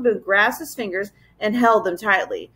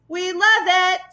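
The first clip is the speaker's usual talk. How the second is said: higher roar; roaring mob; 9 semitones higher, slower than usual. Acted. Furry mob roaring its delight, pitched up high. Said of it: "roared a furry mob".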